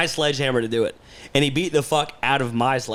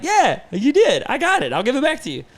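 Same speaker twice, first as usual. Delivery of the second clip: mock eager reply; 10 semitones higher than usual. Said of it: "And I'm like".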